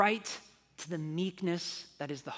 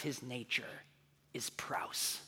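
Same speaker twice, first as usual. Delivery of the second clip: whispers praus, soft and close; whispered reveal; 4 semitones lower, 8 dB quieter, slower than usual. Acted. Pastor revealing God's nature to listeners, whispered. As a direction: breathe the word praus like a secret. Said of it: "revealing God's essence".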